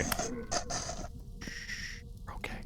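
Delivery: whispered self-reassurance; whispering. Real, not acted. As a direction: hush it to yourself.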